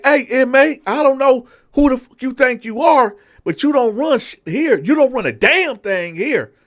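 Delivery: high, loud mock tirade; captain shouting at the inmate; furious captain. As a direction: lay down who's in charge. Captain asserts authority.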